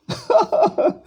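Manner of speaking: high, short burst of laughter; laughing. Real, not acted. Lecturer mocks the opponent shifting his claim, laughing. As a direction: laugh in a high-pitched chuckle.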